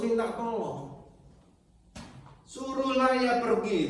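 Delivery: high, loud impassioned preaching; a preacher voicing the woman's desperate begging, high and loud; preaching at full force. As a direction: plead her case to the congregation at full cry.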